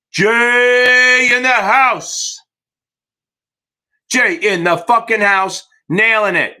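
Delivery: drawn-out, high, loud announcer hype; big slow welcome shout for Jay; hype call. Real, not acted. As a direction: stretch every word like a ring announcer. Hyping a student's result.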